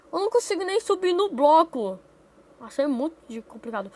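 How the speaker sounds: usual speaking voice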